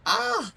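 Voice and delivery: short, very high yelp; sudden high-pitched "ah"; mock yelp. Player acts a character's cry, short high yelp. Character reacts to being left.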